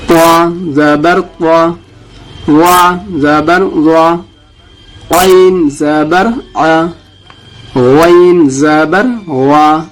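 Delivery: slow, deliberate letter-by-letter spelling; slow recitation drill. Shown as a demonstration. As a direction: spell each letter for students to repeat. Teacher leads letter recitation drill. (آہستہ قاعدہ پڑھانا)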